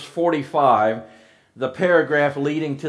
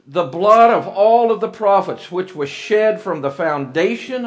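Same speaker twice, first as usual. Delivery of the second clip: loud, raised scripture proclamation; preaching at full force; 3 semitones higher, 4 dB louder than usual. Acted. Preacher reading Jesus' woes to the congregation, loud and raised. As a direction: declaim it like a fiery preacher.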